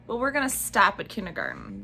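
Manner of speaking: ordinary delivery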